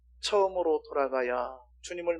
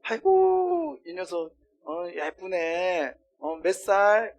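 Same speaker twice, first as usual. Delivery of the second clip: doting adult; 3 semitones higher, much slower than usual. Retold or imitated. Adult stranger fussing over a little child, doting voice. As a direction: coo over a small child. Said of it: "어른들".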